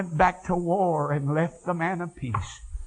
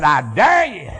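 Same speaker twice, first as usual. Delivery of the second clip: louder dare; 7 dB louder than usual. Retold or imitated. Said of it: "I mean, she said".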